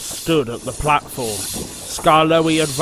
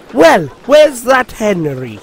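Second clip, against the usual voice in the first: indignant; 7 semitones higher, 8 dB louder than usual. Acted. Indignant engine.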